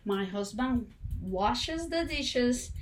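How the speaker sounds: My husband washes the dishes muy despacio; slow demo. Shown as a demonstration. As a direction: marca cada palabra despacio. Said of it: "Y eso es verdad". (modelo lento para los alumnos)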